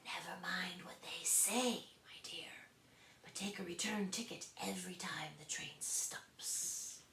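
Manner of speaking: slow whisper in her ear. A narrator's reading. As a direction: whisper confidingly close. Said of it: "whispered in her ear".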